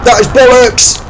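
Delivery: high, loud indignant shout; loud outraged protest; outraged yell. Real, not acted. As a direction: protest it furiously. Outraged at game mishap.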